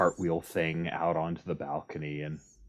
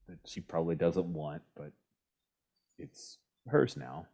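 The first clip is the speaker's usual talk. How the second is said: normal talking voice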